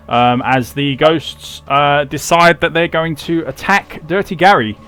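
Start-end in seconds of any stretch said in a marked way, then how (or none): none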